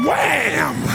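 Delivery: a gleeful playground shout; kid splashing in. Retold or imitated. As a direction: jump in with a gleeful cry. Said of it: "You're like".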